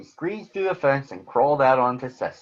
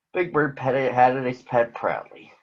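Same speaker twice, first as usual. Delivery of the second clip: plain reading voice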